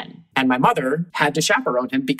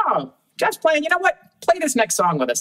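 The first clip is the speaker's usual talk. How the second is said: put-on much higher, eager call to the boy; light up and invite him in; delighted bandleader; 11 semitones higher than usual. Bandleader calling the kid up to join the set, eager high voice. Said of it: "And then they would say".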